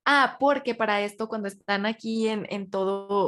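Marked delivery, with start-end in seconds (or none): none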